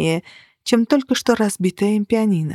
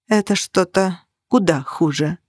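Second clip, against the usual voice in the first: plain reading voice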